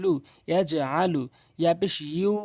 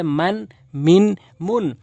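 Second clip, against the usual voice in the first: মান মিন মুন called out much louder, মিন peaked then falling; loud summary drill; 8 dB louder, slower than usual. Shown as a demonstration. Teacher summing up the vowel drill, loud and clear. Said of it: "জবরতে আকার হিসাবে ধরবেন".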